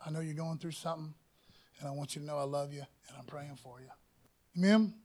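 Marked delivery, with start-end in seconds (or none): 0.0-3.9 soft and quick, falling almost to a hush; gentle reassurance. Retold or imitated. A friend tells a hurting person he prays for them, softly. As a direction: comfort someone who is hurting. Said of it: "the person that says".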